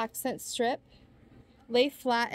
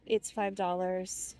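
ordinary talk

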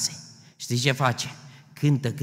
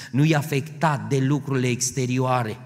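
normal talking voice

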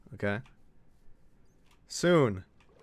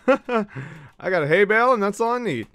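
laughing sing-song; 7 semitones higher, 4 dB louder than usual. Real, not acted. Gamer repeating his hay bale line to chat, laughing. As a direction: savour the line through a laugh.

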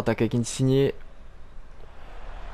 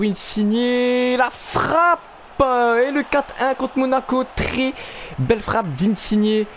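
high shouted goal call; excited sports commentary; 9 semitones higher, much slower than usual. Acted. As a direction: yell the goal, then draw it out. Goal in FIFA career commentary.

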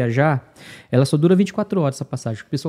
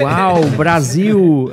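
very slow, drawn-out and louder "Uau, Brasil", savoring each word; mock amazed officer; 2 semitones higher, 9 dB louder, much slower than usual. Traveler imitates the immigration officer's delighted drawn-out wonder. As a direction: stretch "Brasil" out like an impressed border agent. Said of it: "quando ele falou assim".

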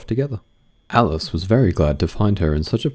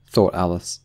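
usual speaking voice

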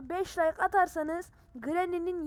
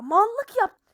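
loud blurt; 7 semitones higher, 10 dB louder than usual. Real, not acted. Blurts out self-mocking confession.

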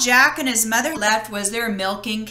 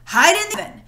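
higher, louder urgent warning; urgent cry; 8 semitones higher, 4 dB louder, much faster than usual. Acted. Giant's wife urging Jack to hide, urgent cry. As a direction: push him to hide, fast. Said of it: "the woman cried".

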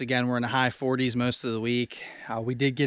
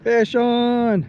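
shouting; 9 semitones higher, 6 dB louder, much slower than usual. Real, not acted. Hooked a fish, calling out.

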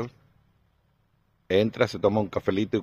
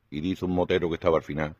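usual speaking voice

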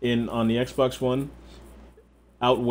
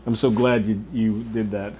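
usual speaking voice

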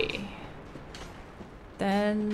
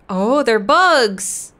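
disgusted surprise; 2 semitones higher, 9 dB louder, much slower than usual. Real, not acted. Discovers bugs on path.

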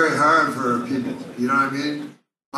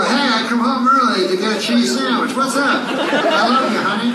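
oblivious husband; 8 semitones higher, 5 dB louder than usual. Acted. Husband greeting cheating wife obliviously.

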